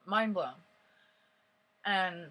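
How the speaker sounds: ordinary delivery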